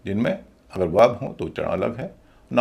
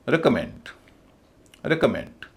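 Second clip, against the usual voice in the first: Recommend as REK-uh-MEND; first and third stress; slower than usual. Shown as a demonstration. Teacher modelling for students, recommend as REK-uh-MEND. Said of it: "तीसरे हिस्से पर stress है और पहले पर है".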